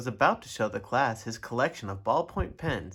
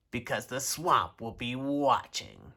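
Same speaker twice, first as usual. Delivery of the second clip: scratchy bossy witch voice; stern witch teacher; 2 semitones lower than usual. A narrator's reading. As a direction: bark orders like a witch. Witch threatening class.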